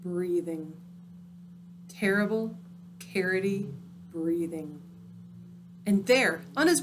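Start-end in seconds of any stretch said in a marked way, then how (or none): none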